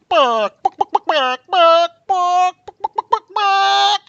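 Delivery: very high, loud angry squawks; yelling chicken. Acted. Angry chicken yelling at the librarian before closing, squawking. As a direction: yell in clucks and bawks like an enraged chicken. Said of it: "it starts yelling at me".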